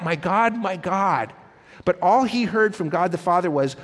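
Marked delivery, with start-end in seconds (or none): none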